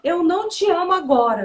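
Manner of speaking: put-on high, loud child's outburst; angry child. Son mad at mom, loud high declaration. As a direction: imitate a child lashing out in anger. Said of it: "quando ele fica com raiva de mim".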